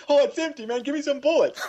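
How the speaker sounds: mock kid whine through laughter; whining kid. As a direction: complain the gun is empty like a kid. Kid complaining about empty pistol.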